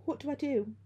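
high, panicked plea; begging. A narrator's reading. Girl begs friend as the ghost nears, panicked pleading. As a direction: beg for an answer, pitched right up. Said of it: "I begged".

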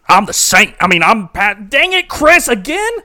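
mock fed-up cry of again; exasperated outburst. Saint exasperated with Pastor Chris, loud mock outrage.

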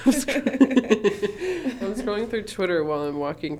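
giggling through the words; laughing. Real, not acted. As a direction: talk while cracking up. Laughing while retelling.

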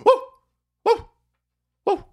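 short high whoops. Real, not acted. Streamer whooping after a laughing fit, excited. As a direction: let out whoops of glee.

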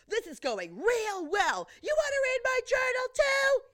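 shouting. Retold or imitated. Daughter accusing dad of snooping, high hammed-up shouting. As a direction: shout it with mock outraged sarcasm. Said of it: "Maureen is still shouting".

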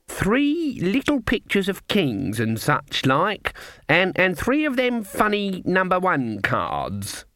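put-on high, slow, naive old lady; innocent old Granny. A narrator's reading. Granny showing her hand to sharpers, innocently. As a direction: describe the cards haltingly in a high voice.

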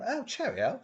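high, soft mock English; cheery English accent parody. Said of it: "alley cat in fucking England".

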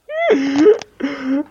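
wheezing high laugh; helpless laughter; choking laugh. Real, not acted. Laughing fit after joke.

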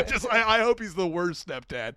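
ordinary talk, nothing marked

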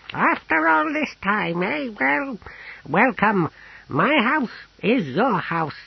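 very high, wondering character voice; effusive apothecary, welcoming. Acted. Apothecary welcomes Pismire after all this time, effusive.

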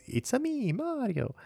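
high-pitched, sing-song Italian cartoon plumber; Mario. Host showing the iconic game voice, high Mario impression. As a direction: squeal it in a high, accented cartoon voice. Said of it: "Mario has that distinctive voice".

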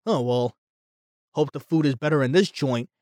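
low, soft, offhand voice; a hint of a shrugging Vulture. Vlogger imitating the Vulture arriving unbothered, low and offhand. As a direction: toss it off without a care. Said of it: "he's like".

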